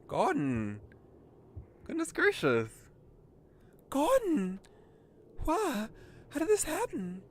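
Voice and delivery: very high, loud cry of alarm; hammed-up high-pitched panic; panicked girl character. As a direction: call out frantically for help in a high voice. Character finds Gordon hurt, calls help.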